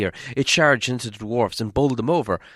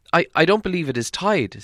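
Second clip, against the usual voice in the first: hobbit voice; 8 semitones higher, 4 dB louder than usual. Acted.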